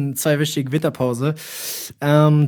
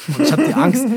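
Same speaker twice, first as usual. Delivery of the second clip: laughing tease; 9 semitones higher than usual. Real, not acted. Teasing, laughing.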